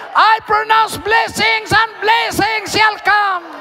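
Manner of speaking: impassioned prayer building to a high shout; fervent prayer preaching. Real, not acted. Preacher praying blessings over a pastor's family, building to a shout. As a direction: build steadily from strong prayer into full-force proclamation.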